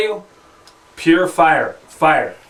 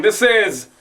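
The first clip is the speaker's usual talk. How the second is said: ordinary delivery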